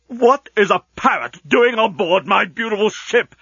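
high-pitched indignant tirade; outraged demand. A narrator's reading. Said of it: "demanded the outraged genius".

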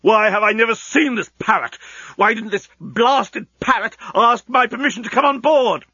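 high, spluttering indignation; ranting, affronted genius; outraged tirade. A narrator's reading. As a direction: pile up the demands in high-pitched fury. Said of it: "demanded the outraged genius".

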